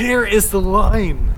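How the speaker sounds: very slow, deliberate warning; firm and slow. Pretend. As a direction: spell out the boundary slowly. Setting a boundary firmly.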